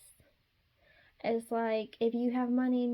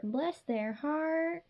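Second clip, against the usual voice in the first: Southern saying; 6 semitones higher, much slower than usual. Pretend. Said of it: "In Southern language".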